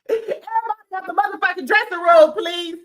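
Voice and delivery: high, loud, fast order to clear out; mock shout. Imitating Doctor Jackie ordering everyone out, loud. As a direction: bark them out of the room, then sweet please. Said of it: "this is what Doctor Jackie was giving".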